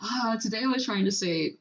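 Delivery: ordinary talk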